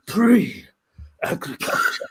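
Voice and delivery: PRE-AGRICULTURE stretched out very slowly; mock-mystical, lingering on every part of "Pre-agriculture"; drawn-out echo. Echoes the word for effect.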